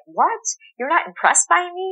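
high, mock-offended what, not impressed; mock-offended star who expects awe; indignant celebrity. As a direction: play the wounded celebrity ego. Mocking celebrity's indignant reaction.

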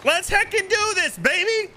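very high rallying cry; hyped up. Real, not acted. Streamer firing up the crew, very high excited cry. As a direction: squeal it out to fire up the crew.

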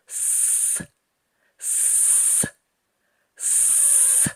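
repeated hissed s sounds; hissing s. Shown as a demonstration. Teacher demonstrates the s sound to children. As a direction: hiss s like a pump. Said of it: "Вимовляємо звук с".

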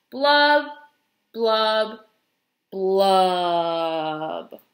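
three blubs stepping down in pitch; glum fish blubs. Acted. Pout-pout fish ending his dreary refrain, sinking glum blubs.

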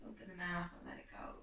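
soft breathy whisper, sighing out. Shown as a demonstration. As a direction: release it in a hushed, quick whisper. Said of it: "Take one last big sigh in here together".

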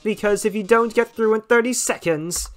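brisk, teasing host patter; smug game-show host. Acted. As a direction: rush the rules with a grin. Mettaton host lines.

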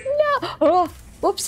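high, sudden mock yelp of dismay; retold cry of no. Remembering getting stuck in Story of Seasons, a high mock yelp. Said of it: "was like".

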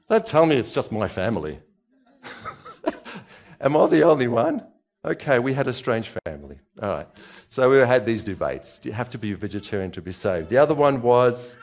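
ordinary talk, nothing marked

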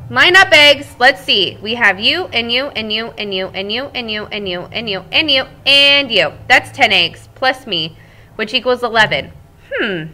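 lively read-aloud voice for the class; storybook narration. Acted. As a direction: tell it like a cheerful egg to children.

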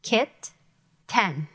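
Kit, ten broken apart with a pause; split syllables. Shown as a demonstration. Teacher splitting kitten into syllables. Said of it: "There's a slash".

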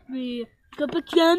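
normal talking voice

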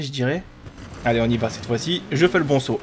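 ordinary talk, nothing marked

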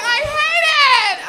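put-on high, slow, drawn-out teen whine; whiny daughter. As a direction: whine it like a sulking kid. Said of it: "She's like".

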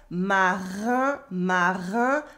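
"marin" with a back-of-throat R, rising at the end; French R. Shown as a demonstration. Teacher showing learners the French R, throaty R. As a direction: sound out "marin", throaty R and nasal "in". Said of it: "the French R that you can hear".

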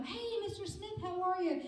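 high-pitched, light hello; mock friendly greeting.

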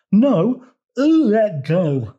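high, loud tongue-tied toad; indignant toad retort. A narrator's reading. Rival toad answers in tug-of-war.

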